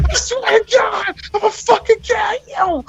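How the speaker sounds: high, strained hysterical shout; Tom Cruise. Retold or imitated. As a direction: imitate a frantic action hero begging and threatening. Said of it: "Tom Cruise was doing his best Tom Cruise".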